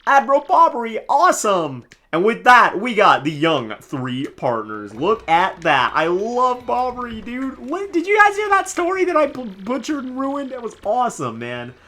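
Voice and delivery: loud, high fanboy excitement; thrilled. Real, not acted. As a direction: gush loudly over the new partner. Streamer thrilled at new partner.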